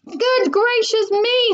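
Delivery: high, slow, drawn-out exclamation; astonished exclamation. A narrator's reading. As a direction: draw it out high in acted shock. Mister Salt watches his wife fall.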